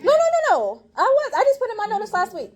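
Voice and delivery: high, loud, hurried self-defence; loud defensive protest. Real, not acted. Teacher defending late news to students, loud high protest. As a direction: cut in over them, pitch way up.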